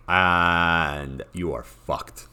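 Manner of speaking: drawn out, each word weighed; slow emphatic doom. Acted. Telling viewers this faction is doomed, slow and heavy. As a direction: let the bad news land slowly. Faction stuck in hopeless position.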